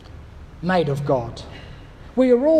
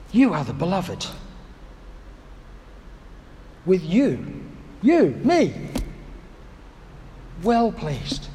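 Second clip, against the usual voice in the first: gentle blessing; 2 semitones lower, 4 dB quieter, slower than usual. Pretend. God's words again, gentle pauses.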